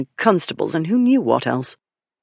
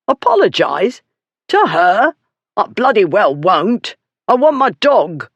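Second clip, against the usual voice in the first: higher, louder indignant refusal; outraged refusal; 6 semitones higher, 4 dB louder than usual. Acted. Bullard refusing Ripley, higher and outraged. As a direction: scoff at the very idea.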